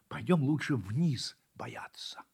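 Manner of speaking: slow scared whisper; frightened whisper. Acted. Puppy whispers to the kitten, scared of the rain. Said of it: "ему на самом деле стало страшно".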